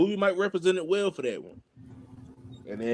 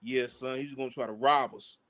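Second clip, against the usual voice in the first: New York calm; 5 semitones lower, 4 dB quieter than usual. Retold or imitated. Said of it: "in that New York sense of mind".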